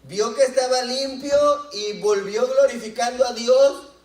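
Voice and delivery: más alto y más fuerte, proclamando; léelo gritando de gozo; en alta voz. Shown as a demonstration. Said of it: "Esta me gusta más". (leído a gran voz)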